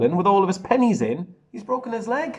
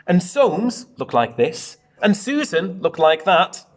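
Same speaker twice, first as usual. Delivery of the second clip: put-on lively read-aloud to children; bouncy storybook narration; story reading; 4 dB louder than usual. A narrator's reading. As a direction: read it out like a picture book for the class. Teacher reads picture book aloud.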